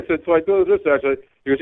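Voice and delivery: ordinary talk, nothing marked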